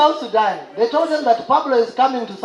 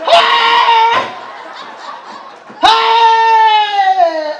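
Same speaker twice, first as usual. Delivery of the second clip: panicked yell; 9 semitones higher, 6 dB louder than usual. Acted. Comic panic at threat.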